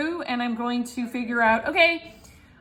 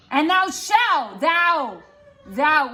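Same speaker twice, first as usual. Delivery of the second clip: slow, pointed thou; accusing thou; 3 semitones higher, much slower than usual. Shown as a demonstration. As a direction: jab each thou at the accused. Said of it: "whenever I say thou, he's accusing".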